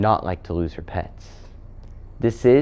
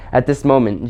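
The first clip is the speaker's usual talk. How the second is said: ordinary delivery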